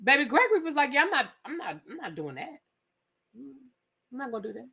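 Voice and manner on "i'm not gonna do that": usual speaking voice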